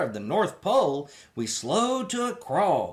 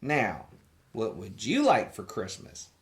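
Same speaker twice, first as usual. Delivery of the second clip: a hint of gentle Santa; 9 semitones lower, 4 dB quieter than usual. A narrator's reading. Santa asking the boy on his knee, low and soft. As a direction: lower and softer, kindly. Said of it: "he asked".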